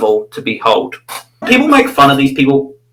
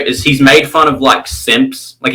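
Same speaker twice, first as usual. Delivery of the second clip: ordinary delivery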